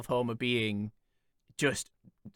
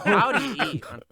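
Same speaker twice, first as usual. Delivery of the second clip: loud, high exasperation breaking into laughter; worked-up rant; 6 semitones higher, 6 dB louder, faster than usual. Real, not acted. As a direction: protest in laughing disbelief. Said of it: "you've set him off now".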